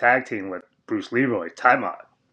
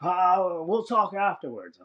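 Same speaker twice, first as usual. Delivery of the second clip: high mock flustered boss; Vince; 11 semitones higher than usual. The boss imitated realizing he fired Jimmy, high and flustered. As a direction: toss it off high, fake-shocked, then brush it aside. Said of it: "I was like".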